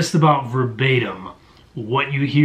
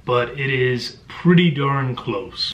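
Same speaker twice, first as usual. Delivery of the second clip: plain reading voice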